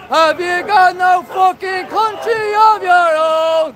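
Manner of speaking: drawn-out taunting shout; mocking shout. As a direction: bellow it out slowly, taunting. Shouting at passers-by.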